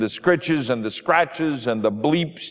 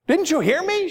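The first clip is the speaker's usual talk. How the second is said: pitched way up, exasperated; exasperated outburst; 13 semitones higher than usual. Acted. Ruth, in hospital, snapping at the pastor, exasperated and high. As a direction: throw the question back at him, fed up. Dying woman snaps at pastor.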